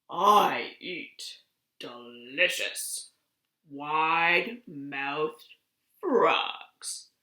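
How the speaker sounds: menacing alligator. Acted. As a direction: savor each word like a hungry predator. Alligator threatens the frog.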